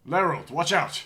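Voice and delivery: high, loud yell of alarm; shouting a warning. Real, not acted. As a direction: yell to warn a friend. Companion attacked in game.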